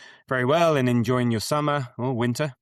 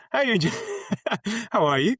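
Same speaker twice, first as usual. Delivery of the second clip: laughing while asking; 10 semitones higher, much faster than usual. Real, not acted. Laughing mid-question.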